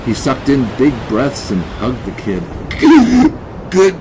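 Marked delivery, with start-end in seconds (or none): 2.5-3.7 high, loud shuddering noise; chattering shiver. Acted. Man soaked and shaking, chattering. 3.7-4.0 broken, shivery words; teeth chattering. A narrator's reading. Wet man praises the kid, teeth chattering. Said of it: "he chattered".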